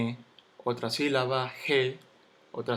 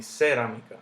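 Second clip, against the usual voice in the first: cerámica stressed on CE, CÉ-ramica; wrong first stress; 3 dB louder than usual. Shown as a demonstration. Teacher rejecting a wrong version, cerámica stressed on first CE. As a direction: punch the opening CE of cerámica. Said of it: "Yo no digo cerámica".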